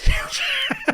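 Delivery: high delighted laugh; burst of laughter. Real, not acted. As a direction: crack up at the roll. Natural twenty surprise.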